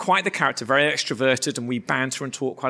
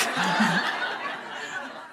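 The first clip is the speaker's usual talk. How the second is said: long, airy laugh trailing away; real laugh; 4 semitones higher, 4 dB quieter than usual. Real, not acted. Preacher amused by teammate's wording, real laugh. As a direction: laugh helplessly at your own punchline. Laughs at the quoted juju line.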